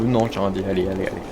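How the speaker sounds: normal talking voice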